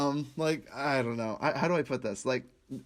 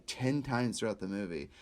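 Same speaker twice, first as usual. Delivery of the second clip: ordinary delivery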